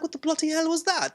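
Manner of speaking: high-pitched mock husband voice; Vision. Vision reacting to the neighbor breaking character, high baffled imitation. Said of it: "Vision was like".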